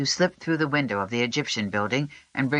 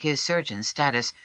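ordinary delivery

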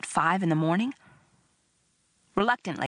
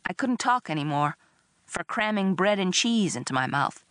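plain reading voice

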